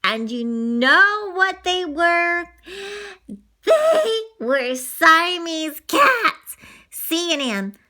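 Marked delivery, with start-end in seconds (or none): 2.5-6.6 gasp, then slow loud reveal; dramatic gasp reveal. Pretend. Child revealing the scary Siamese cats slowly.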